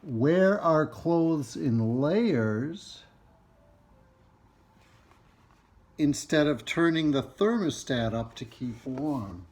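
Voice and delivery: slow, stretched-out key words in a read-aloud; reading to children. Acted. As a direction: read each phrase out deliberately for children. Reads book to children.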